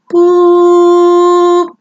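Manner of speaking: loud, high steady "boop" held long; long electronic-style test tone by voice; imitated ringing tone. Shown as a demonstration. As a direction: mimic a tinnitus tone on one sustained high note. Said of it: "here's how it sounds".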